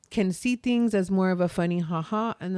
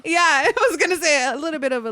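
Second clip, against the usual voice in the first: laughing through the words; giggling as she agrees; 8 semitones higher, 6 dB louder than usual. Real, not acted. Host laughs as cohost rephrases the word, laughing.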